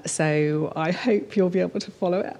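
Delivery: ordinary delivery, nothing marked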